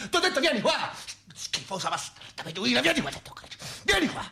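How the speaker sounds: calling her out, high and fast; mock-furious taunt; challenging yell. Real, not acted. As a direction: dare her to come over. Challenging the spitter to come.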